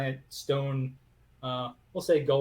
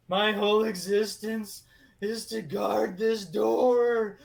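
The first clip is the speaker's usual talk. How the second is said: high, loud, slow lament; weeping golem; 8 semitones higher, 6 dB louder, slower than usual. Acted. Golem telling Lucia the source of his sadness, high, loud, slow and weepy. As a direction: pour out the golem's misery, slowly.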